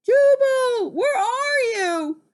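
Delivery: high, drawn-out search call; calling from afar. Acted. Friend searching the storm for Jubal, calling out. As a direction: holler as if across a distance. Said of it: "A faint voice called in the distance".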